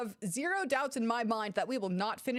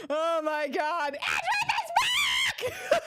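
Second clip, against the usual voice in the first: shrieking, drawn-out yell of joy; excited scream; 5 dB louder, much slower than usual. Real, not acted. Fan reacting to a character's return, excited scream. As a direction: scream it high and loud.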